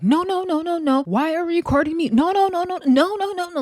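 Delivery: squeaky high no-no-no, drowning her out; shrill repeated no to talk over someone; mocking overtalk. [name] overtalks Janelle, acted out.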